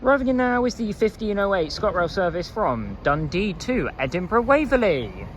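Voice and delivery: usual speaking voice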